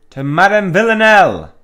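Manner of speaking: high, booming mock proclamation; rallying cry. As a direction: shout it like a call to set off. Player mock-proclaims next destination.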